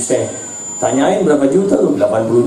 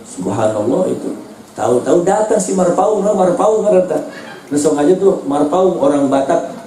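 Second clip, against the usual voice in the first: normal talking voice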